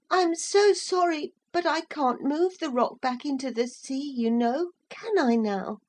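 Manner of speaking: put-on high child voice; gently sorry boy; apologetic little boy. A narrator's reading. Boy answers mussels.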